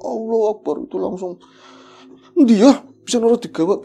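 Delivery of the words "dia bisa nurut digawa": high, loud urgent exclamation; tense exclamation. Retold or imitated. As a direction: cry it out in alarm. Ki Waslam exclaiming in the tense scene. (seruan tegang, tinggi dan keras)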